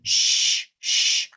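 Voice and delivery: voiceless "Shh", sustained; quiet sh sound. Shown as a demonstration. Teacher demonstrating mouth shape, quiet sustained sh. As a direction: hold "Shh" as pure air, no voice. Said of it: "Kinda like that quiet sound".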